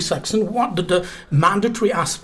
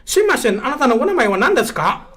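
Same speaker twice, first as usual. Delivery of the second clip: English teacher mimicking a Japanese accent; light Japanese accent, quick and bright; 4 semitones higher, 3 dB louder, faster than usual. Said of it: "such kind of Japanese accent".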